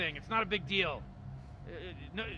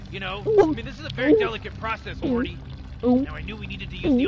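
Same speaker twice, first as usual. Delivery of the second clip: much higher, louder mock rant; sudden outburst; 8 semitones higher, 10 dB louder than usual. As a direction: blow up on the delicate process line. Rick bursts out mid-explanation.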